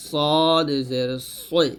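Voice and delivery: সদ জের সই, very slow with heavy rounded s; thick sad. Shown as a demonstration. Teacher models sad for learners, heavy drawn-out সদ. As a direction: stretch সদ full and thick. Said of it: "সদ মোটা করে".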